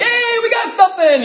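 high-pitched cheer from another room; excited wife. Retold or imitated. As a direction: imitate a wife calling out in delight. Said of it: "his wife in the other room".

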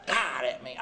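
ordinary talk, nothing marked

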